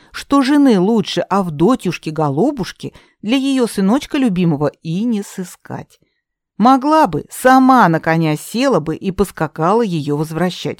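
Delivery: usual speaking voice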